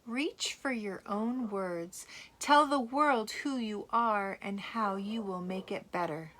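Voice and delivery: ordinary delivery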